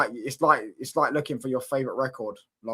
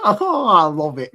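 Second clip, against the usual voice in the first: laughing delight; 2 semitones higher, 7 dB louder, slower than usual. Real, not acted. Laughs at rival's misery.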